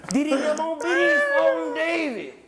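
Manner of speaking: excited call out. Acted.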